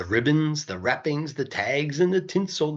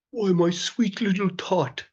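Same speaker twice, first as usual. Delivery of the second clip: lying Grinch; 4 semitones higher than usual. A narrator's reading. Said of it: "the fake Santa Claus lied".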